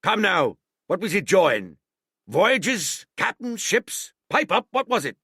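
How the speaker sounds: high, loud sneering echo; mocking scorn. A narrator's reading. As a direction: throw his words back at him. Silver mocking Morgan's answer.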